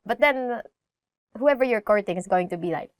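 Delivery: ordinary delivery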